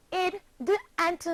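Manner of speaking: the sounds "Id", "d" and "t" said high and separate; teacher modeling sounds. Shown as a demonstration. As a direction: spell out "Id", "d", "t" one by one for the class. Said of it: "you're going to join in with me".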